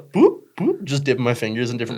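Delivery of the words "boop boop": high squeaky boop boop; playful boops. Acted. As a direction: chirp it like a cartoon. Playful sound while answering.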